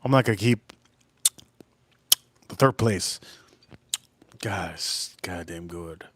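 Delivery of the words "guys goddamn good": low, soft savouring murmur; hushed appreciation. Real, not acted. Telling stream viewers the whiskey is good, hushed. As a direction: drop low and quiet, really impressed.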